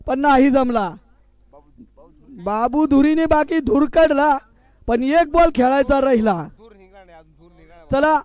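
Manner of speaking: ordinary talk, nothing marked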